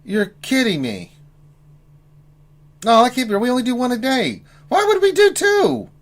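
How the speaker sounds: can't believe it, voice climbing high; shocked disbelief. Real, not acted. Shocked by double booking.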